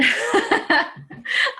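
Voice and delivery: a high, happy burst of laughter; delighted laugh. Real, not acted. Laughs at her success.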